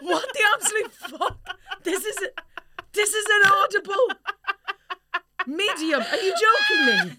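high, loud disbelieving laugh; shocked laughing shriek. Real, not acted. Shocked by goose honk.

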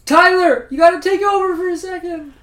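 high, loud call while jumping; shouting mid-jump. Acted. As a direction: yell it out as you leap away. Calling out mid-jump to partner.

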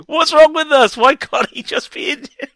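high, loud re-enacted protest; exasperated outburst. Podcaster re-enacting last night's outburst, exasperated. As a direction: burst out in pained disbelief, laughter creeping in at the end. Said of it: "I literally burst out going".

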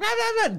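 high-pitched imitated yapping yell; mock yelling noise. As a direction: mimic someone yelling in nonsense syllables. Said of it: "she's always like".

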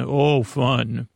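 normal talking voice

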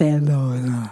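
Barcelona with a lisped th, Barthelona; Catalan lisp Barcelona. Retold or imitated. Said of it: "you say".

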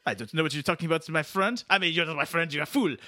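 put-on defensive French-Canadian accent; testy French-Canadian backpedalling; Quebecois [name]. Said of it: "said it with a French accent".

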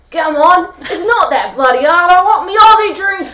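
loud, high, exaggerated bogan Aussie accent; laughing impatient bogan Aussie demand, loud and high. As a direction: ham up an impatient broad Australian customer, laughing. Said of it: "goes in her accent and exaggerated".

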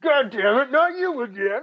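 high, loud fed-up groan; exasperated exec voice. Pretend. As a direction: whine it like a worn-out boss. Mock exec on the phone.

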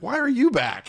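can't believe he came back; mock disbelief at the returning thief; incredulous. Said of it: "And I'm like".

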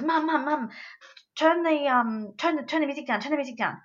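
a hint of an urgent little boy; urgent child voice. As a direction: mimic the small son calling Mum, pressing. Child son voiced urgently in story.

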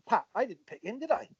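high, teasing voice imitating Jack; Jack's mock question to his wife. Manager asking his wife, high and mock-innocent. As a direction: raise the pitch and tease as the manager. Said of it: "he goes".

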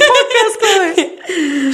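drawn out with a laugh, like a radio host; mock podcast voice. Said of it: "I like podcast".

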